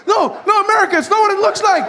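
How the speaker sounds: high, hurried excuse; mock panicked pleading. Caught sandcastle builder pleads with America, mock panic. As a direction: plead frantically, hammed up for comedy.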